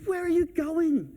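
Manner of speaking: high, drawn-out, eager question; overjoyed doorman impression. Happy doorman asking departing guests, high and slow mimicry. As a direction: imitate a delighted doorman calling out. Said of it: "He was so happy".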